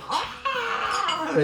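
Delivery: very high, drawn-out scream; play-acted shriek of terror; hammed-up horrified wail; mock scream. Acts out guest's terrified reaction.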